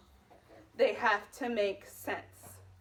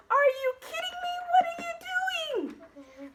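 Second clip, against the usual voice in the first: full of energy and excitement; 4 dB louder than usual. Shown as a demonstration. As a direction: exclaim in disbelief. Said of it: "full of energy and excitement".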